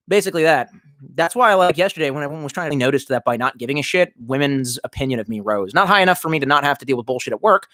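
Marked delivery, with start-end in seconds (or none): none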